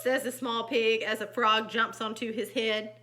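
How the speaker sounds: animated, loud exclamations between narration; lively storytime voice; story narration. A narrator's reading. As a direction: read to children with dramatic emphasis. Reads picture book aloud.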